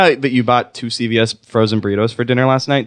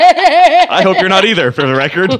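high mock fatherly voice; high put-on voice teasing an imagined kid; silly voice to son; 7 semitones higher, 5 dB louder than usual.

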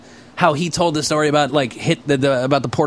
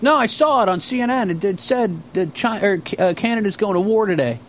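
high, naive stumbling insistence; Ray voice; 6 semitones higher than usual. Retold or imitated. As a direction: imitate a clueless guy defending his story. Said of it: "and he goes".

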